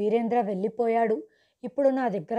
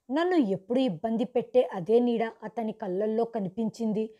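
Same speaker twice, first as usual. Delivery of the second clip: usual speaking voice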